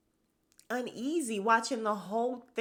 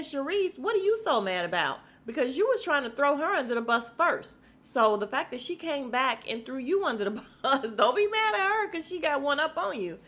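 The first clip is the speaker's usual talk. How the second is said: loud, raised scolding; heated scolding; 6 semitones higher, 5 dB louder than usual. Real, not acted. Me scolding Sharice for being mad, heated. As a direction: tell her off, fired up.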